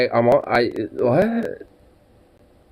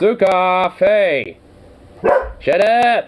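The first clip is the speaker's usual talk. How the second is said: higher, sharp shouted command; yelling at dog; 7 semitones higher, 4 dB louder, much slower than usual. Real, not acted. Owner shouting at the barking dog Sukov, sharp yell.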